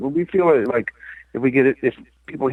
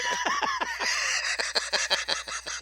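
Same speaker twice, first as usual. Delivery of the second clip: big laugh; 9 dB quieter than usual. Real, not acted. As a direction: laugh out hard. Guest bursts out laughing.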